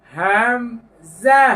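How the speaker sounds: slow deliberate হামজা, no lengthening; letter name Hamza. Shown as a demonstration. Teacher demonstrating হামজা for students. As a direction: say হামজা clean and unstretched. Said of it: "আয়না দেখে দেখে বলুন".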